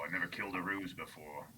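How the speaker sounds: low, very soft character voice; dim orc. Pretend. Player's orc ponders an unknown foe, low and soft. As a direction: drop low and quiet, puzzled orc.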